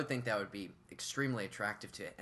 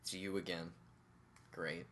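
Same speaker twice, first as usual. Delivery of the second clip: low, soft mock-bored delivery; monotone; 5 semitones lower, 9 dB quieter than usual. Unexcited princess greeting her rescuer, monotone. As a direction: imitate an underwhelmed princess. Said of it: "monotone game of hide and seek".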